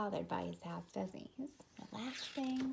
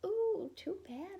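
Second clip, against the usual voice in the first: high, slow, drawn-out "Ooh, too bad"; neighbors' mock pity; 10 semitones higher, 4 dB louder, slower than usual. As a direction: sing out fake sympathy like nosy neighbors.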